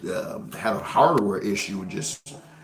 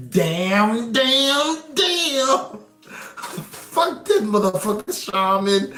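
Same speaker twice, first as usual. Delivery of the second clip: mock heartbroken cursing; 11 semitones higher, much slower than usual. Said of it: "You gonna be like".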